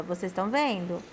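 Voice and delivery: ordinary delivery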